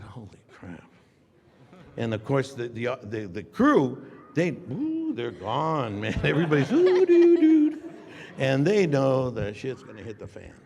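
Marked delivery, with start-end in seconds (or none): 0.0-0.8 breathy whisper of dismay. Retold or imitated. Actor alone on set, whispering in dismay. Stunned reaction retold. 6.7-7.7 high, laughing ooh doo doo; mock ominous hum. Crew sensing trouble, humming a high mock warning.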